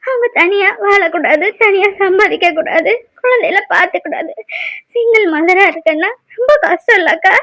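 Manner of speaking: halting speech through tears; tearful lament. Acted. Single mother laments to elder sister about living alone, tearful. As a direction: pour out the hurt between sniffs. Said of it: "இங்கே அழுதுட்டு இருக்குது".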